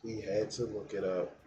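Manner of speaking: very soft, low-pitched murmur; hushed aside. Real, not acted. Streamer repeating that he had to look it up, hushed aside. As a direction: say it softly and low, almost to yourself. Repeats a jab after long pause.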